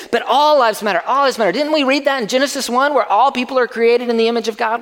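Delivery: mock objector. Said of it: "you wanna stand up and you wanna say".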